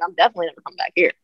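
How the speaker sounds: rattled off very fast, mock dismissive; quick brush-off. [name] telling Boston friends she won't return, rattled off fast. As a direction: toss it off fast, no room for doubt. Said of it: "I'm like".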